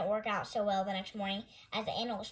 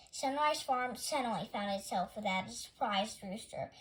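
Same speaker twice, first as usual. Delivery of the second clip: usual speaking voice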